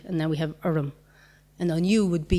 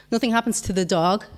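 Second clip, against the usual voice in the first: ordinary talk, nothing marked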